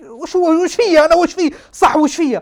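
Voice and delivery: high, loud, repeated demand; panicked questioning. Retold or imitated. Patient demanding what illness he has, panicked. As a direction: plead for an answer, pitched right up.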